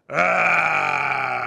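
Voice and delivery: loud drawn-out pirate arr; pirate growl. Retold or imitated. Host saying it for the mayor in the booth, loud pirate arr. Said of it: "to say".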